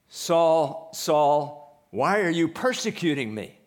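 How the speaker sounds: a voice booming out of the light; voice from heaven. Retold or imitated. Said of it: "And what was that voice?".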